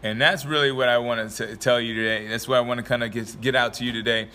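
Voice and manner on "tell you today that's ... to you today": ordinary talk, nothing marked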